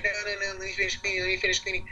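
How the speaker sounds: imitated girlfriend, high and insistent; pleading girlfriend. Girlfriend insisting on cleaning first.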